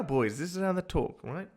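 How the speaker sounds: normal talking voice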